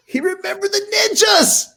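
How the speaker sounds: very high, hammed-up fanboy squeal; thrilled shout. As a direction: shriek it like a starstruck fan. Acting out his thrilled inner reaction.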